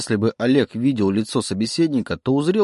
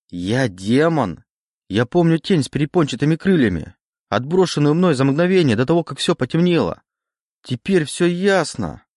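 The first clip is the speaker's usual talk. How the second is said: excited realisation; 6 semitones higher than usual. A narrator's reading. Oleg works out what he is, excited. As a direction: piece it together with rising excitement.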